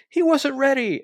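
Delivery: high, loud indignant yelp; shrieked mock-commentator protest; imitated yell. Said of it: "Starks yells".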